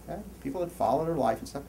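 ordinary talk

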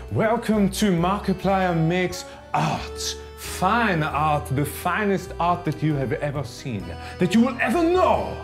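hammed-up French art host accent; fake French accent. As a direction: play a pompous French artist, thick mock accent. Hosts in a put-on French accent.

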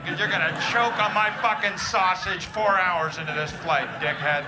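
loud, driving comic rant; angry tirade. Acted. Tired dad threatens the single guy on the flight, angry tirade. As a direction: lay into him at full volume. Acted furious rant at napping passenger.

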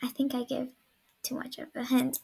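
whispering. Real, not acted. Hiding, whispering.

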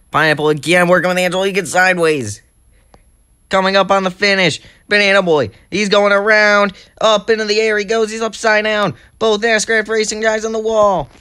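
high, loud race-finish call building to a peak; climactic play-by-play. Real, not acted. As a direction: shout the last-lap drama through to the winner.

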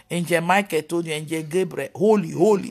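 low-pitched mock-pious recital; low, mock-reverent listing of holy names; mocking imitation. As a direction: lightly parody someone claiming holy messages. Mocks false claims of revelation.

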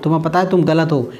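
usual speaking voice

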